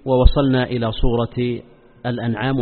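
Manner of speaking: ordinary delivery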